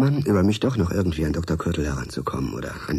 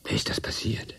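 whisper; 2 semitones higher, 5 dB quieter than usual. Acted. Shocked at news of death.

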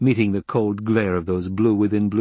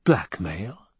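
dry tone. A narrator's reading. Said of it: "Jessica spoke in a dry tone".

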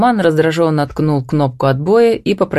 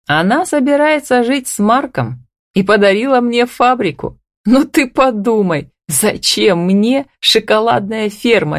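upbeat, higher-pitched announcement; joyful; 6 semitones higher than usual. A narrator's reading. Groom giving his bride the chocolate factory, lightly joyful. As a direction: toss it off with light happiness. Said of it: "радостно бросил он".

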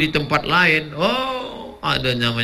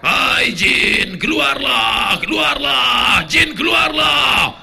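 higher, louder, chanted command to the jinn; commanding shout; 9 semitones higher, 5 dB louder, much slower than usual. Acted. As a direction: cast out the jinn at full voice. Peruqyah mengusir jin. (teriakan mengusir jin)

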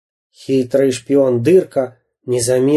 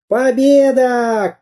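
high, slow victory cry; triumphant cry; 10 semitones higher, much slower than usual. A narrator's reading. Самоделкин cries out while beating the pirate, triumphant. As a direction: draw out a high cheer of triumph. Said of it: "кричал Самоделкин".

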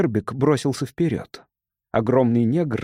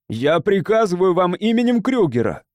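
higher-pitched commanding shout; 6 semitones higher, 4 dB louder than usual. A narrator's reading. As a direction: call out the order forcefully. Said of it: "закричал Кинрос". (властный крик)

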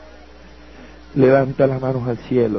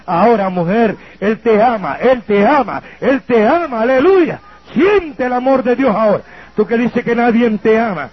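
rising, fervent ministry call; fervent preaching; 6 semitones higher than usual. Acted. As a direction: build her up with rising fervor. Ministra a una mujer. (predicación ferviente a la mujer)

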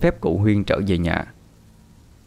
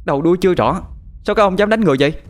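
high, raised scolding voice; angry shout; 10 semitones higher, much faster than usual. A narrator's reading. Daughter confronting men who beat her father, angry shout. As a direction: lash out in defence, quickening at the end. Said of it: "Bà tôi lao lên quát".

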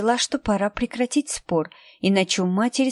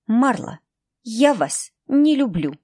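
slow, each word clearly set apart; very distinct; 4 semitones higher, slower than usual. A narrator's reading. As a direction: spell it out word by word. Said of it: "очень отчётливо произнесла". (девочка отчётливо говорит помощнице отца)

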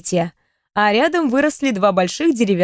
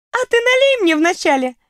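хихикая; 10 semitones higher than usual. Acted. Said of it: "хихикнула Кьяра".

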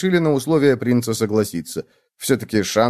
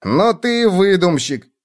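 high, drawn-out mocking exclamation; high scoffing bug voice; crying bedbug; 8 semitones higher, slower than usual. A narrator's reading. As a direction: accuse gleefully, high and slow. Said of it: "закричал Клоп".

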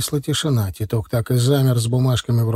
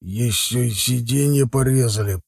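slow, muttered complaint; grumbling. A narrator's reading. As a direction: grumble it sourly in Zakhar's voice. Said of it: "пробурчал он и выматерился".

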